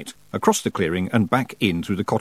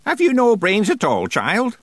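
put-on scolding old witch; 12 semitones higher, 3 dB louder than usual. A narrator's reading. Miss Treason scolds, high.

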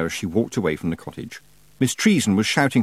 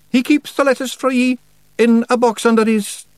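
high, loud Scots character voice; reluctant Feegle confessing; 7 dB louder than usual. A narrator's reading. Rob's character voice in dialogue.

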